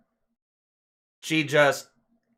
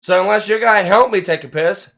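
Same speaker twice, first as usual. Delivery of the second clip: higher and louder, yelling at them; angry yell; 8 semitones higher, 9 dB louder than usual. Retold or imitated. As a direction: let it rip at the intruders. Retelling yelling at intruding women.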